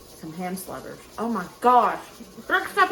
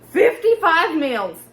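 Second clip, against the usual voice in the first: dramatic emphasis; 6 semitones higher, 9 dB louder, slower than usual. Real, not acted. Dramatic reveal of meal count.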